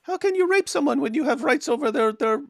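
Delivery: mocking preacher. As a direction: ham up the scholar's scorn. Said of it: "And he goes".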